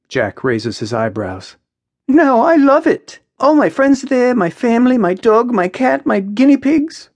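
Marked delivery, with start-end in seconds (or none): 2.1-7.1 high, loud, eager character voice; Maya, emphatic. Acted. Maya defends her life in Sydney, emphatic. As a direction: rattle off the list with high-pitched enthusiasm.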